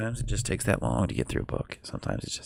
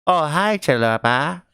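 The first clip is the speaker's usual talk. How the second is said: high pet voice; 9 semitones higher, 5 dB louder than usual. Real, not acted. Greeting the pets.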